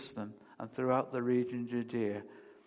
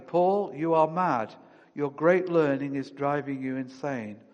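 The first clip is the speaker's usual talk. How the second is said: ordinary talk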